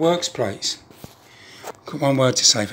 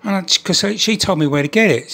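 ordinary talk

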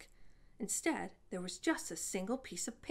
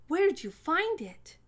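higher, louder eager question; a girl rushing down, breathless to know; 9 semitones higher, 9 dB louder than usual. A narrator's reading. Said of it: "asked Yoon-hae breathlessly".

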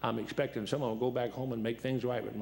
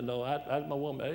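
ordinary delivery

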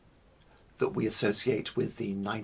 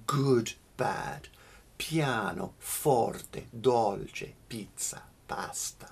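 piano, forte, dolce, pizza, pasta each hit on the first syllable, second falling away; good-bad rhythm, strong then weak; first-syllable stress; slower than usual. Shown as a demonstration. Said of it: "those words go good, bad".

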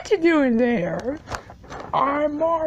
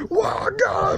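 voice cracking shout; 6 semitones higher than usual. Acted. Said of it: "my voice changes".

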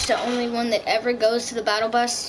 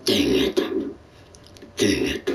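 deep robotic voice changer; low electronic changer voice. Real, not acted. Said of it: "why am I using my voice changer?".

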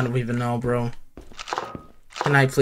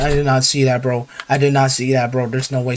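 normal talking voice

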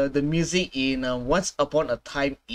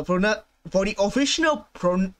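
normal talking voice

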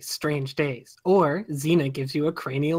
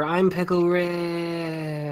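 Pickle Rick voice; much slower than usual. Acted. Quoting Pickle Rick catchphrase.

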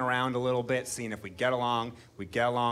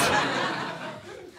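breathy laugh; 8 semitones higher than usual. Real, not acted. Laughs at his own joke.

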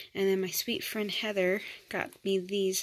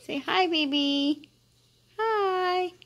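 higher, sing-song cooing at a newborn; baby talk; 9 semitones higher, 4 dB louder, slower than usual. Acted. Mom cooing at her newborn daughter, sing-song baby talk. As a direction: coo sweetly over the baby.

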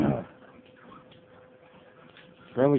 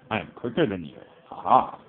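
usual speaking voice

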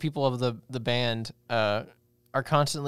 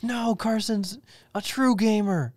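high, put-on pleading voice; high-pitched, hammed-up fan; mock devoted fan; 6 semitones higher than usual. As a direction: plead in mock defence of your idol.

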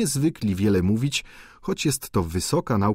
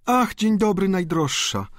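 gushing greeting; 8 semitones higher than usual. A narrator's reading. Viscountess greets the duchess, gushing and high. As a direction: greet her dearest friend effusively. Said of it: "zawołała, idąc na spotkanie".

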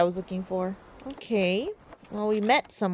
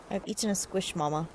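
ordinary talk

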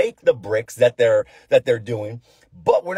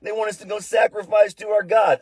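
mocking; 8 semitones higher than usual. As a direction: throw their plea back at them with a jeer. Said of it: "He's, like, mocking them".

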